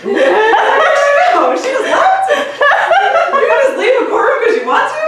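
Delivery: high, loud, astonished questions; amused, loud disbelief at high pitch; incredulous. Retold or imitated. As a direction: boggle at it, voice shooting way up. Acting out baffled reaction in court.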